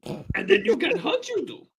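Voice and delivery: very high put-on creature voice; Hachudu. Shown as a demonstration. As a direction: squeak it out as a game character. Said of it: "two characters which I know, you know, how they're gonna be voiced".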